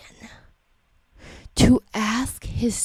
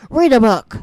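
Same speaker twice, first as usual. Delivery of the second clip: loud, higher, emphatic command; exasperated shout; 4 semitones higher, 10 dB louder than usual. Real, not acted. Parent telling students what to do instead, exasperated shout. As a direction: burst out with it in exasperation.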